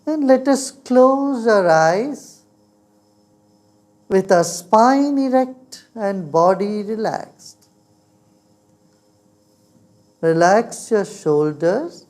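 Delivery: guided meditation. Real, not acted.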